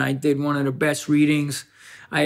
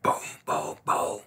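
knocking noise; 13 semitones lower, 9 dB quieter than usual. Retold or imitated. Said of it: "I went up to the door and I'm like".

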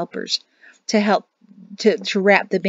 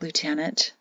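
softer, lower call to the lieutenant; 3 semitones lower, 7 dB quieter than usual. A narrator's reading. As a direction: hush it so the patient can't hear. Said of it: "said in a low voice".

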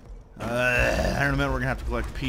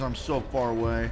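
normal talking voice

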